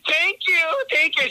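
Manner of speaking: gushing thanks. Retold or imitated. Hugging the TSA lady, retold.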